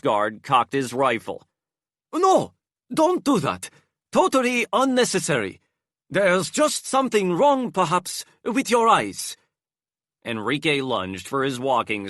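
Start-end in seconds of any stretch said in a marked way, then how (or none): 2.1-9.4 very high, loud put-on voice; nervous disguised plea. A narrator's reading. Enrique pleads with the armed guard, very high voice. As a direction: talk him down, hurried.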